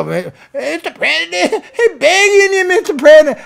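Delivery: high, pleading mock voice of a begging senator; mock pleading Turtle. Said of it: "old Turtle".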